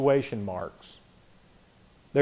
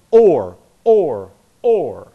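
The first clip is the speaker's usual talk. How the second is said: each or said louder and clipped short, fading a little each time; punched or; 6 dB louder, much slower than usual. Shown as a demonstration. Teacher drilling the repeated or for the class, punching each one. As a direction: hit or, or, or like drumbeats. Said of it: "this is why I emphasize things".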